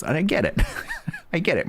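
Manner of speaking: laughing through the words. Real, not acted. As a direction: laugh while admitting it. Laughing at the comment.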